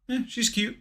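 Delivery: half-hearted, hushed approval; noncommittal shrug. Acted. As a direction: play it down with a quiet, indifferent shrug. Indifferent answer voiced to a partner.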